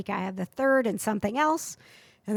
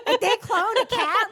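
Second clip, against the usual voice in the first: laughing amazement; 12 semitones higher, 4 dB louder than usual. Real, not acted. Amazed at the cat story.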